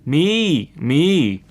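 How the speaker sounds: loud storyteller's bleat; goat bleating. A narrator's reading. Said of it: "the goat bleated".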